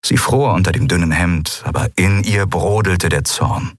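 usual speaking voice

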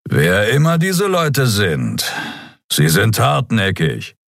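slow, grudging respect; a hint of admiration for the enemy; almost approving. A narrator's reading. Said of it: "Fast anerkennend sagte er". (fast anerkennend, langsam)

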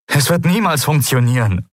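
high-pitched hopeless protest; agitated outburst without a shout; exasperated cry. A narrator's reading. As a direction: protest in despair, pitch jumping up. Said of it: "rief er".

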